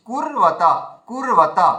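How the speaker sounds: কুর held long, silence, then ওয়াতা; drawn-out, then pause. Shown as a demonstration. Teacher shows the joined consonant pulled back, কুর held apart from ওয়াতা. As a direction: stretch কুর and break before ওয়াতা. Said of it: "কুর বলে যে আমাদের যে একটা হাওয়া থেমে".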